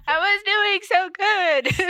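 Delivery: high, slow words through real laughter; giggling at her own fumble; laughing. Real, not acted. Reading fanfic to cohost, laughing at her own slip.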